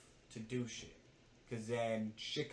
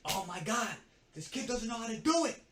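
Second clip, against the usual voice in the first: high, loud exasperated yell; 9 semitones higher, 6 dB louder than usual. Retold or imitated. Upset coworker complaining about the new kid, yelling. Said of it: "She's yelling at me".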